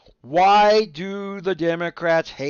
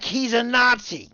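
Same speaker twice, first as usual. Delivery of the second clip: mock accusation; 8 semitones higher, 4 dB louder than usual. Mocking political accusation.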